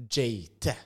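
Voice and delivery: Jate said with an English J sound; Jate, English style; pronouncing Jate. Shown as a demonstration. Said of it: "Du uttaler det".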